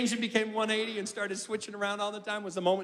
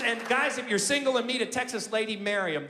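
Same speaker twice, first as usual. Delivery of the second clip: ordinary talk, nothing marked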